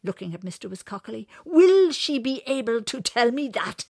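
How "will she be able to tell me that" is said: high, loud angry demand; louder and angrier. Acted. Winters presses for an answer, loud and angry. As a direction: demand it with rising temper. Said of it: "His voice was getting louder".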